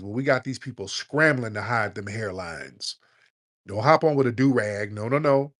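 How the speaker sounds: ordinary delivery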